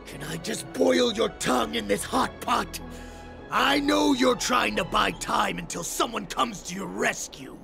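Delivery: menacing and fed up; impatient threat. Acted. Captor threatens a stalling captive.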